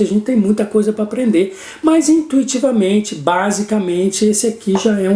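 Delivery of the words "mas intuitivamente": ordinary talk, nothing marked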